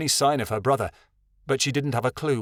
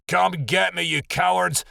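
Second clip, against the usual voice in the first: higher, boldly taunting shout; 6 semitones higher, 3 dB louder than usual. A narrator's reading. Shadowin dares the pack to chase him, shouting boldly. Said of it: "Shadowin shouted boldly".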